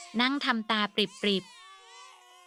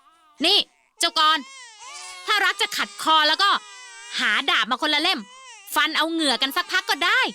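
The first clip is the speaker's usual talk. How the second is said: loud, higher furious scolding; harsh angry voice; 7 semitones higher, 5 dB louder than usual. Acted. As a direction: threaten the friend hotly. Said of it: "พูดด้วยเสียงแข็งกร้าว".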